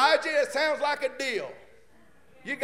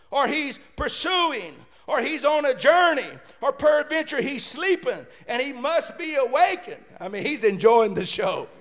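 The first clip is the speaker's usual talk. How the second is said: usual speaking voice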